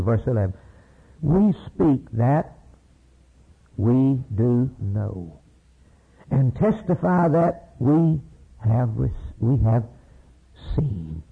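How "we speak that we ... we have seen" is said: slow weighty scripture reading. Acted. Slowly preached scripture quote.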